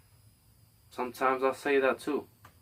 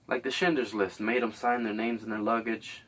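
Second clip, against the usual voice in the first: normal talking voice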